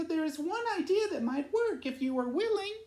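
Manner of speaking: high, light swallow voice; Lolita's voice. Acted. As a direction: pitch it up like a small bird. Lolita offering an idea.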